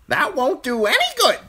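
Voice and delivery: very high, loud bird squawk; scolding crow. A narrator's reading. Crow scolding crying Bran, high squawk.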